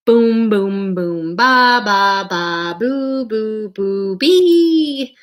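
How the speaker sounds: booming brass oompah sounds; tuba imitation. Narrator imitates the tuba during the lesson. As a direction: sing the tuba notes out loud.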